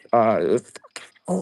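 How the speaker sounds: usual speaking voice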